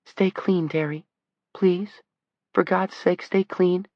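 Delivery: put-on high pleading teenage boy; begging adolescent voice. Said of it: "the adolescent voice begged".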